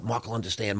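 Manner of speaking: quietly calm him down; soft reassurance. Retold or imitated. Wife softly reassuring him the groom won't mind. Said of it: "Stephanie's like".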